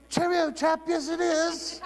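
high-pitched, hammed-up British accent; mock British accent. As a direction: parody a chirpy British gent, voice way up high. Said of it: "they have a beautiful accent".

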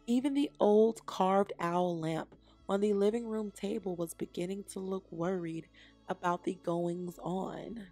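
ordinary delivery

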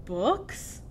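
puzzled, rising one-word question; baffled pirate captain; confused. A narrator's reading. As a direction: ask as if the word were foreign. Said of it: "Braidbeard looks confused".

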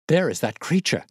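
sudden high excited cry; pointing and crying out in alarm. A narrator's reading. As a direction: call it out, startled and urgent. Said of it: "cried Grant Munro".

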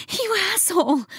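high, exasperated female cry; frustrated woman. A narrator's reading. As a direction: snap at him, cheated. Frustrated woman cursing her lover.

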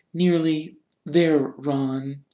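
lightly voiced soothing girl; Hermione soothing. A narrator's reading. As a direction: coax him onward.

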